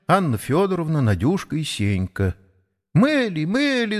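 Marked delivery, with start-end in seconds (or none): 2.9-4.0 put-on high childish cry with lisped r; delighted little girl. A narrator's reading. Little Nadyushka claps on seeing the horse, high childish delight. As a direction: call out like an excited toddler. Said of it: "захлопала Надюшка".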